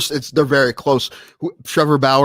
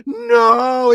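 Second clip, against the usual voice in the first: mock dismay; 5 semitones higher, 4 dB louder, much slower than usual. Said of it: "watching T V like".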